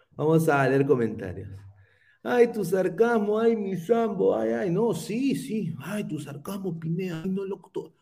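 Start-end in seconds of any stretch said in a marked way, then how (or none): 2.2-7.9 high, fussy mock whining; exaggerated high-voiced moaning; mocking critics. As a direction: imitate whiny complainers, pitched up. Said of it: "se quejaban de la ironía".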